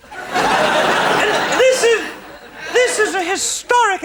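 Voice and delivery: very slow, high grand opener; drawn out. Acted. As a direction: stretch it out grandly.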